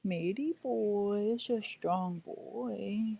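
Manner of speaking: drawn-out encouragement for a brave dog; slow praise. Real, not acted. Owner praising her injured dog, slow tender coo.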